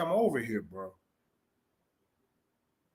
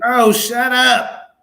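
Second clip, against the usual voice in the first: slow, loud brush-off; 4 semitones higher, 11 dB louder, much slower than usual. Real, not acted. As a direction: shut him down, drawn out. Brushing off a heckler.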